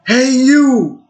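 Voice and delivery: high, loud shout across the street; sudden cop's holler; screamed call. Retold or imitated. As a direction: yell it down the block at him. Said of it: "he screams out".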